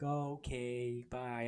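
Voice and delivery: slow, soft, crestfallen goodbye; mock-hurt little voice, drawn out and quiet; dejected paperclip assistant. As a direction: let it trail out softly, acting wounded. Voices dejected Clippy being sent away.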